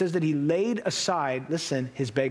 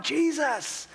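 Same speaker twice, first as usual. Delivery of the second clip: high mock scream; 9 semitones higher than usual. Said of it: "began to scream out".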